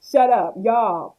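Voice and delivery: imitating her mother scolding the kids, loud; mock mother. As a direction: play the fed-up mom. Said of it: "That's exactly how I went in my household".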